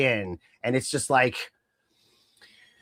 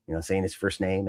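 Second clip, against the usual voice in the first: ordinary talk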